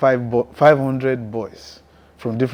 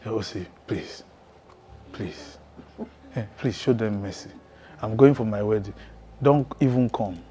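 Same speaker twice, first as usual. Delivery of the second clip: quiet, half-laughing imitation of a friend begging; lightly voiced plea for mercy, much softer; soft mock pleading; 4 semitones lower, 11 dB quieter than usual. Retelling how the Capone begged him, in a soft pleading voice.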